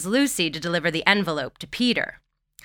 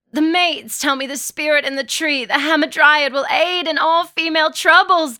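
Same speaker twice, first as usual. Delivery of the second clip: high, pained gasping voice; 9 semitones higher, 5 dB louder than usual. A narrator's reading. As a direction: gasp it out through cramps, pitched up. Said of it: "gasps Lydia".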